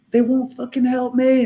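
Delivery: high, pleading imitated cry; retold hysteria. Partner crying that the hospital refuses help, hysterical. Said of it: "screaming hysterically".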